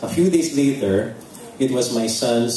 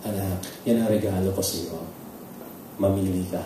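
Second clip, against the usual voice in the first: quiet tender gift from God; gentle gift; 6 semitones lower, 7 dB quieter than usual. Retold or imitated. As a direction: tell her kindly. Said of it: "he told me".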